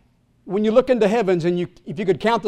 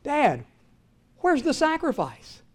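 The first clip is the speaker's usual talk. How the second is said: put-on soft boy's question; 5 semitones higher, 6 dB quieter than usual.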